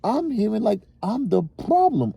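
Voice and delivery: exasperated father's rant; getting pissed. Real, not acted. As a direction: defend yourself, rising annoyance. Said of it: "I'm starting to get pissed".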